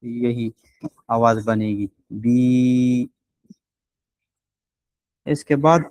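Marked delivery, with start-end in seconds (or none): 2.1-3.1 بی held with a full ya length; long bi. Shown as a demonstration. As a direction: draw بی out steadily. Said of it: "گول یا جو ہے اس کو ساتھ آپ ملا لیں".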